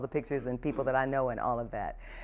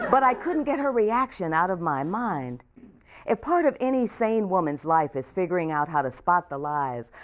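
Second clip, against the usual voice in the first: a clear, louder reading voice for the audience; memoir reading; 2 semitones higher, 5 dB louder than usual. Acted. Author reading her memoir aloud to a hometown audience. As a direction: read the pages out to the room with care.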